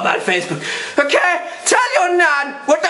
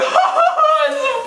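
high, mocking fake laughter; exaggerated high-pitched mock amusement; 9 semitones higher than usual. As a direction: imitate someone cackling at their computer. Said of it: "you're sitting on a computer going".

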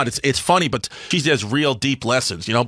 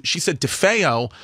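normal talking voice

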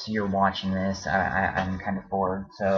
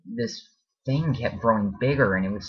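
ordinary delivery

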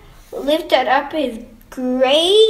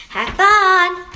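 higher, louder parting call; cheerful send-off; 7 semitones higher, 6 dB louder than usual. A narrator's reading. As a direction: call out a happy goodbye. Mother sees child off.

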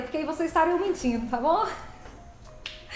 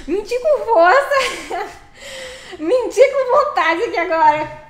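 loud, laughing mock boast; lying loudly; 5 semitones higher, 7 dB louder, slower than usual. Streamer performs a big joking lie for chat, loud and laughing. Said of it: "Menti bonita agora".

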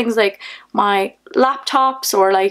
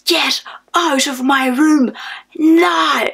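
put-on higher-pitched angry yell, Northern Irish accent; 5 semitones higher, much slower than usual. Ordering a party stranger out of her room, yelling in Northern Irish. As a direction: bellow it slowly, hard Northern Irish, building to the last word. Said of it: "Northern Irish accent on and I'm yelling".

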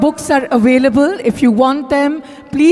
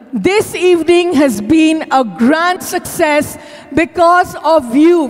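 ordinary delivery

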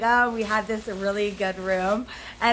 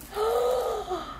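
a sharp, high gasp of delight; excited gasp; 9 semitones higher, 4 dB quieter than usual. Real, not acted. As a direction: gasp at the surprise, high and thrilled. Opening a gift, sees stickers.

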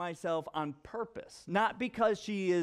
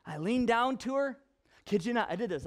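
ordinary talk, nothing marked

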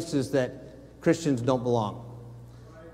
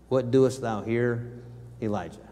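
solemn voice of God; 5 semitones lower, 6 dB quieter than usual. Acted. God's words read solemnly.

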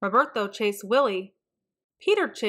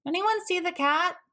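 higher calling voice, looking for the cat; 9 semitones higher than usual. A narrator's reading. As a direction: call out for the missing cat. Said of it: "Archie called".

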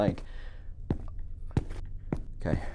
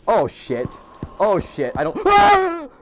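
startled yell jumping very high and loud; panicked shout at a jump scare; real scream; 11 semitones higher, 4 dB louder, slower than usual. Real, not acted. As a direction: shriek as the monster jumps out.

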